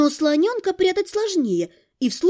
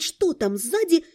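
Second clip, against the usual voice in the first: usual speaking voice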